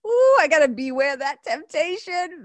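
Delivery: high, loud, hammed-up ooh of dismay; mock dismay. Host mocking herself for the temptation line, hammed-up and loud. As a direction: overplay being caught by the warning.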